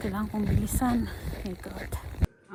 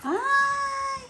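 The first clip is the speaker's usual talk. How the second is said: much higher yelp of surprise; 14 semitones higher than usual. Real, not acted. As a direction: yelp it up high. High exclamation.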